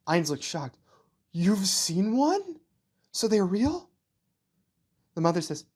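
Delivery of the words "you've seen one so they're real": put-on high, very slow child voice full of wonder; a small boy who can't believe trees are real; amazed young boy. Ainz learns his mother has seen trees, high slow amazed child voice.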